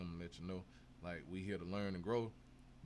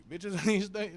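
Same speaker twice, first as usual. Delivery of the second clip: laughing; 9 semitones higher, 9 dB louder, slower than usual. Real, not acted. Laughing about people cashing out.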